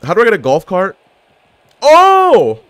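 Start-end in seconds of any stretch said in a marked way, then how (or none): 1.8-2.6 high, loud shocked cry; startled gamer blurting out; shocked yell. Real, not acted. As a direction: yelp in sudden alarm. Sudden shock in game.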